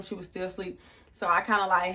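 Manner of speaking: ordinary talk, nothing marked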